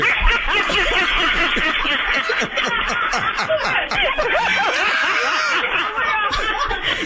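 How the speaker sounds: long, high peals of laughter; real helpless laughter. Real, not acted. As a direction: burst out laughing in relief. Pranked clerk bursts out laughing.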